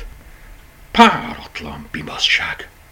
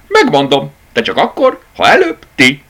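much higher and louder, coy; teasing rabbit; 9 semitones higher, 8 dB louder than usual. A narrator's reading. Rabbit bargains with the toys, high and coy. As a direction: bargain playfully.

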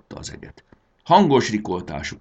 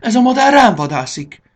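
high, loud, panicked cry; desperate shout; 11 dB louder than usual. A narrator's reading. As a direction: scream that you are under attack. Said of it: "kiáltott Fanny kétségbe esetten". (kétségbeesetten kiáltva)

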